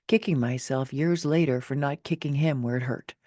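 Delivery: usual speaking voice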